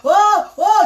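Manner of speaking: high anguished moan; wailing. Retold or imitated. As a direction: cry out in grief. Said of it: "je commence à pleurer".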